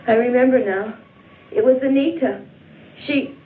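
rising pitch as the memory returns; dawning horror. Acted. Injured woman recalling who attacked her, alarm building. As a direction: let alarm build with each phrase.

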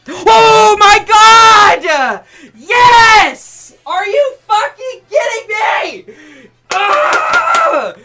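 high, loud screams of disbelief; ecstatic screaming. Real, not acted. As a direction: shriek for joy after a five-day hunt. Streamer finally gets the shiny.

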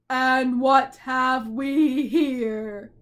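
very loud, very slow booming giant; a slow, thundering giant voice; giant's roar. A narrator's reading. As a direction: boom it out like a huge giant. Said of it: "he roared".

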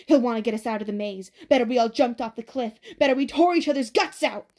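loud rant building in pitch; wild raving. A narrator's reading. Crazed Ben warning the others about Thomas, raving.

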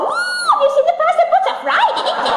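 put-on high squealing old dears at the fair; gossiping old ladies. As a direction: squeal it like excited pensioners gossiping. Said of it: "And they all go".

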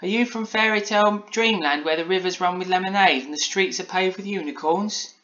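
put-on high-pitched wondering child; Polly in wonder. A narrator's reading. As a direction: ask like an awestruck little girl. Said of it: "replied Polly in wonder".

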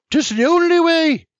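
high, loud, decisive; firm grandma. A narrator's reading. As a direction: cut him off loudly as grandma. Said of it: "With a slap of her palm to the table".

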